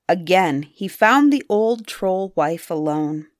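ordinary delivery, nothing marked

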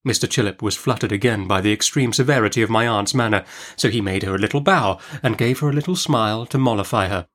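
plain reading voice

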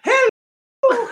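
very high, loud granny hello; old lady voice. Acted. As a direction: sing out hello like a sweet old grandma. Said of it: "give us your best old lady voice".

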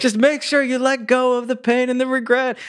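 high, strained mock call; calling over noise. Friend calling advice to Leaf over the van, high. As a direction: holler it high, half laughing.

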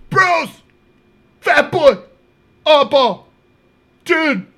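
high, loud mock hollering; neighbor calling out. Neighbor waking the teller at five, high-pitched hollering. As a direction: holler short bursts like the worked-up neighbor. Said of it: "This, my neighbor".

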